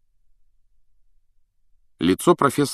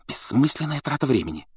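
higher-pitched, restrained hiss; hissed annoyance; 7 semitones higher, 3 dB quieter than usual. A narrator's reading. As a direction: hiss it under the teacher's hearing. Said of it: "прошипела Гермиона". (раздражённо шипя)

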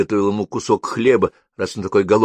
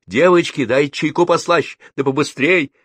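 high, loud, urgent order; brisk raised-voice call; shouting; 8 semitones higher, 4 dB louder than usual. A narrator's reading. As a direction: shout it to the kitchen staff. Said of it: "закричала".

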